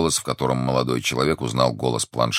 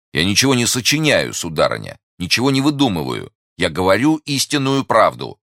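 higher, louder indignant protest; heated insistence; 7 semitones higher, 4 dB louder than usual. Acted. Young man protesting to a doubting lady, heated insistence. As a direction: insist on your honesty, pitch jumping up.

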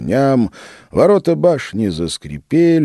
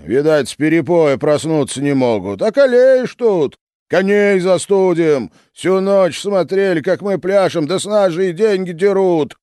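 angry shout; 12 semitones higher than usual. Acted. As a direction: shout the complaint at the gate. Freezing crowd grumbles at gate.

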